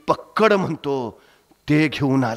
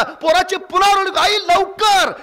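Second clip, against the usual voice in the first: rising urgency; 4 dB louder than usual. A narrator's reading. Building to climax.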